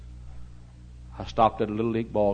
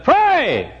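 high shouted command to a dog; loud command; 12 dB louder than usual. Retold or imitated. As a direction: call the trick out loud and high. Preacher orders the dog.

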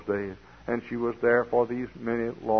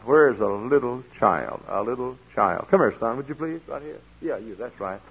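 normal talking voice

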